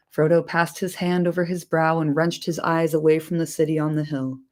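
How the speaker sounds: plain reading voice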